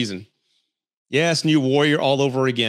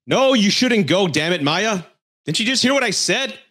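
exasperated scolding; 7 semitones higher, 3 dB louder than usual. Retold or imitated. Host scolding caller Maya over her ex, angry raised voice. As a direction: scold her like she never listens. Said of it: "Pisses me off".

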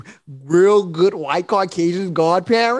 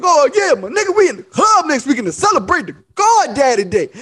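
excited agreement; 7 semitones higher, 4 dB louder than usual. Retold or imitated.

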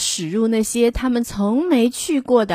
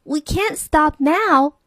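eager lady; 7 semitones higher, slower than usual. A narrator's reading. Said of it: "said the lady with the pram".

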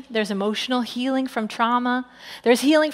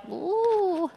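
mock wave ooh; 7 semitones higher, 5 dB quieter than usual. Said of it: "the waves were like".

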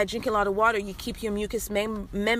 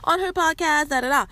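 higher, mocking second-hand report; mock quoting; 9 semitones higher than usual. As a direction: parrot someone repeating what they heard, pitched way up. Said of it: "So don't be going like".

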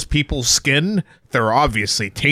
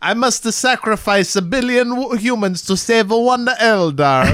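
mock Eldar seer; 8 semitones higher than usual. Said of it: "playing an Eldar".

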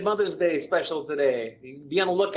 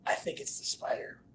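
whisper; 11 dB quieter than usual. Retold or imitated. Customer quoted, retold story.

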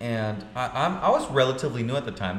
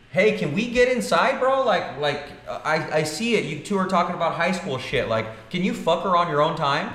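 higher, pushier rant; fed-up outburst; 7 semitones higher, 3 dB louder than usual. Retold or imitated. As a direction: lose patience, telling him off. Retold angry outburst at realtor.

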